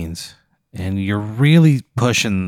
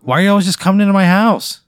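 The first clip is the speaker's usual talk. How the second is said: annoyed outburst; 8 semitones higher, 5 dB louder than usual. Acted. Player annoyed at intruding wizard.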